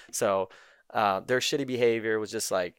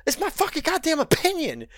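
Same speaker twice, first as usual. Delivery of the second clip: high, louder, indignant retort; exasperated outburst; 3 dB louder than usual. Retold or imitated. Retelling his outraged reply to the taggers, high indignant yell. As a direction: snap back in disbelief at the pushy taggers. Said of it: "I'm like".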